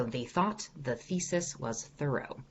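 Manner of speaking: "They thought the thesis was thorough" said slowly, every th with the tongue pushed forward; slow tongue-forward TH drill. Shown as a demonstration. Coach demonstrating the TH drill for the class, slow and precise. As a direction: stretch each th in "thought" and "thesis", tongue between the teeth. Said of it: "using a lot of that T H sound".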